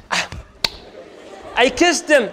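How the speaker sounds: ordinary talk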